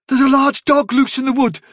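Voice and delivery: high-pitched alarm, held slightly back; urgent warning. A narrator's reading. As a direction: warn them firmly, pitched well up. Warning remembered, first take.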